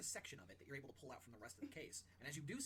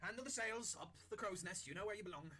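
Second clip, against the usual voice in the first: bossy crew order; 6 semitones higher, 5 dB louder than usual. Acted. Chef ordering lizard to work.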